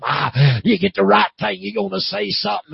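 high, loud revival preacher; fired-up preaching. Real, not acted. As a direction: exhort the congregation with force. Preaches at full force.

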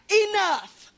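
sharp shouted command; shouted rebuke at the enemy; screamed out. Retold or imitated. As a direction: bark it out like a rebuke. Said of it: "So I just screamed out".

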